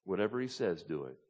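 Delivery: submissive. Acted. Mary turning to the servants, quietly submissive. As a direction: say it quietly, yielding completely. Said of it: "That's an attitude of submission".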